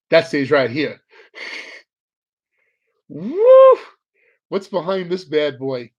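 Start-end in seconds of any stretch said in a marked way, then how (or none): 3.1-3.8 high, loud whoop of joy; excited whoop. Real, not acted. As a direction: whoop with delight. Card pulled.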